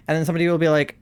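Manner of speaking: ordinary talk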